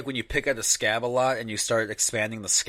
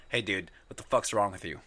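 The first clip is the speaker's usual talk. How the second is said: ordinary talk, nothing marked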